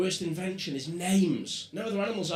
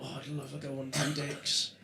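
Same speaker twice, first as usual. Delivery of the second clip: hushed dreamy wish, much softer; soft secret longing, dropped low; quiet confession; 6 semitones lower, 10 dB quieter than usual. Retold or imitated. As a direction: murmur it like a secret thought. Imagined male inner thought.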